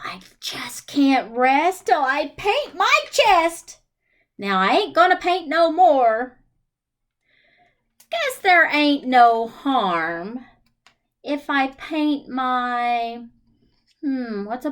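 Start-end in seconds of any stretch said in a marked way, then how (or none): none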